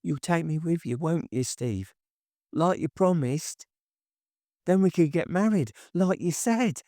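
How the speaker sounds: put-on wistful pleading girlfriend; Tracy, hopeful pleading. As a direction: plead with him, wide-eyed. Tracy's voice in dialogue.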